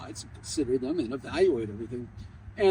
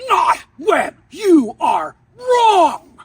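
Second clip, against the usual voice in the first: very loud, much higher, slow furious yell; really mad shout; 12 semitones higher, 13 dB louder, much slower than usual. Retold or imitated. Enraged professor shouting the final lesson at the student, very loud. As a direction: blast each word at him. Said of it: "Now he gets really, really mad".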